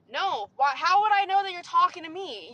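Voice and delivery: loud, high, exasperated comeback; indignant retort. Retold or imitated. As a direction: snap back loudly at being blamed. Acted retort to coworkers.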